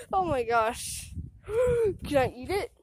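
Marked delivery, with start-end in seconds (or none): none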